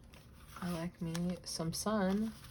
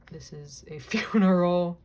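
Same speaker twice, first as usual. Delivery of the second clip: laughing; 11 dB louder than usual. Real, not acted. Realizes the photo is a funeral.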